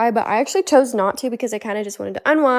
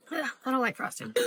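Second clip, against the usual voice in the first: muttered; 11 dB quieter than usual. Real, not acted. Muttering over bad frosting.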